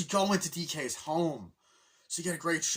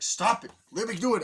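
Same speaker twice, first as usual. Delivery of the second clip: high, loud complaint; acted squabbling outburst; mock protest shout; 9 semitones higher, 8 dB louder than usual. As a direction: yell it like a pestered character. Acting characters squabbling in a fight.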